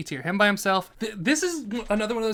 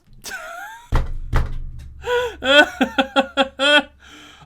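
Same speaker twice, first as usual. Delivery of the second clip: high, loud burst of laughter; 13 semitones higher, 8 dB louder than usual. Real, not acted. Ranker cracking up at their own announcement, loud laugh.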